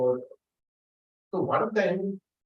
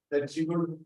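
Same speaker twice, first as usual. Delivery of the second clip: normal talking voice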